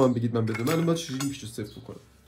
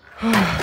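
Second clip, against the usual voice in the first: loud frustrated sigh; 9 semitones higher, 8 dB louder than usual. Real, not acted. Frustrated by missing screws.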